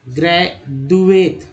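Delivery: ग्रेजुएट said slowly as "gre-duet" with a dental द; slow demo of ग्रेजुएट with a dental द; with a soft D. Shown as a demonstration. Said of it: "अब द के लेकर उच्चारण करते हैं".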